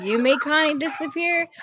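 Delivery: ordinary talk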